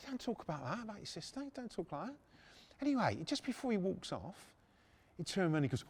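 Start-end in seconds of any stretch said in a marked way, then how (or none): 0.1-2.2 soft, higher-pitched acted plea; embarrassed retold hush; hushed reproof. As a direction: quietly tell him to drop it. Hushed reproof about the sister.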